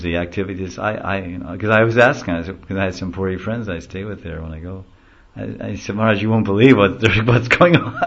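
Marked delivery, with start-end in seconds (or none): 6.0-7.9 quick, loud, through a laugh; laughing retold question. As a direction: ask Maharaj while chuckling. Quoting his own question, laughing.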